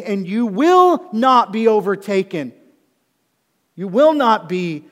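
loud, emphatic pulpit delivery; forceful preaching. Acted. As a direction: drive each promise home loudly.

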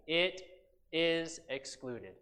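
EXCLUDED said slowly and deliberately; measured weight on "is excluded"; emphatic. Acted. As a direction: set IS EXCLUDED apart after a pause, slow and firm. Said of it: "He says very emphatically".

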